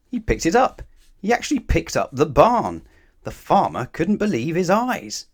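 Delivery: loud, high, wide-eyed wonder; amazed storytelling. A narrator's reading. Narrator telling children the barn is lifted, loud and amazed. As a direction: sell the astonishing moment to the children.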